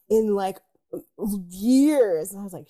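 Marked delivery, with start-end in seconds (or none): none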